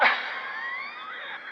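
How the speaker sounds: sudden high cackle of disbelief; sudden laugh. Acted. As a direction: burst into a high scornful cackle. Gert laughs off Wyatt's praise.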